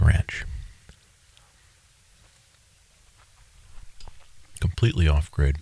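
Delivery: talking while eating an orange; mouth full. Real, not acted. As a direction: speak around a mouthful of orange. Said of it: "I'm eating my orange as I'm telling this".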